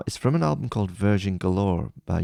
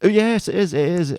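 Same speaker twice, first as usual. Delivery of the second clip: higher and louder, the penny drops; sudden realization; 12 semitones higher, 7 dB louder than usual. Real, not acted. Hearing the chorus, suddenly sure it's the song, surprised. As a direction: blurt it out as it clicks.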